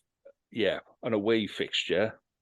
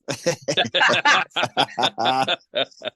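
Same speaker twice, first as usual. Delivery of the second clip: long, loud burst of laughter; laughing; 5 semitones higher, 9 dB louder than usual. Real, not acted. Cracking up after insulting a co-host, laughing. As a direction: burst out laughing, higher and louder.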